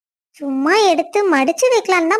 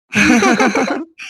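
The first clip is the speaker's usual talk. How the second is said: low chuckle; 6 semitones lower than usual. Acted. Playful banter laugh.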